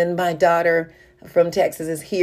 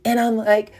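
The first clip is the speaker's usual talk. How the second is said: ordinary talk, nothing marked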